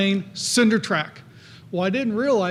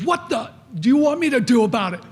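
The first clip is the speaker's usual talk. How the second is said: mock yell; 4 semitones higher than usual. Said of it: "Mister Popwell just yelled at you".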